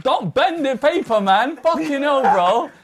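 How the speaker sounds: high, urgent protest; real alarm. Real, not acted. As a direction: plead in alarm to save something precious. Alarmed the paper is being bent.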